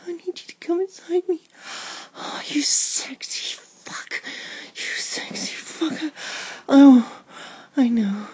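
loud, high, breathless cries; urgent pleading. Acted. Narrator begging partner at the climax, urgent cries. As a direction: cry it out in short, breathy bursts.

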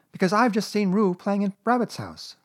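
put-on high, loud child's voice; boy Christopher Robin. A narrator's reading. Christopher Robin judging Piglet, high boyish certainty.

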